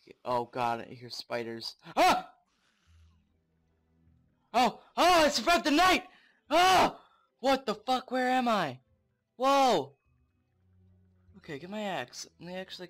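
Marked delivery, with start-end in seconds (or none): 2.0-9.9 high, loud startled exclaiming; excited shout. Real, not acted. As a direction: burst out in relief and shock. Survives night, startled by place.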